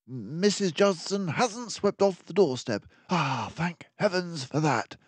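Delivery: a sharp-minded sleuth laying out the danger; keen detective warning of the villains; mouse detective. Acted. Detective relieved at doorstep.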